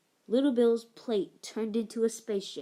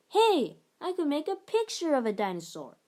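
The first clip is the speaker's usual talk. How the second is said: higher, louder sudden idea; exclaiming; 6 semitones higher, 5 dB louder than usual. Acted. Boy suddenly thinking of a game, excited exclaim. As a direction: burst out with the idea. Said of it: "he exclaimed".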